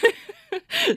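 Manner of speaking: high giggling squeal; squealing laugh. Real, not acted. Laughing again.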